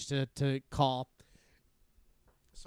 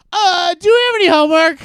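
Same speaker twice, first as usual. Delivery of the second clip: higher, louder, flustered question; panicked cover; 19 dB louder than usual. Retold or imitated. Boy covering his nerves by asking his crush about homework, flustered. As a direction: blurt it out in a panic.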